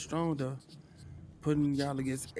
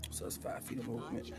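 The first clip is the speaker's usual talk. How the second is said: ordinary talk, nothing marked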